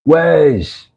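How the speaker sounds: high British-accented "Wes". Shown as a demonstration. Showing how they say his name in the UK, British accent. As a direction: say Wes the UK way, ending in a Z. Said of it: "in the U K they say Wes with a Z".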